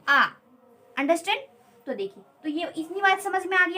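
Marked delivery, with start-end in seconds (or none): 0.1-0.2 "आ" as one long open vowel; correct pronunciation. Shown as a demonstration. Teacher summing up how to pronounce it, pronunciation demo. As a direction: model "आ" with the final sound left open. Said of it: "pronunciation करना है".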